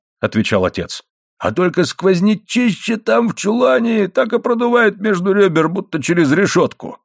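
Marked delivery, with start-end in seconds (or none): 1.4-7.0 high-pitched grumble about the cold; complaining husband. A narrator's reading. Husband complains to wife of the draught, high-pitched grumbling. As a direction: voice an indignant husband, easing down in pitch toward the end.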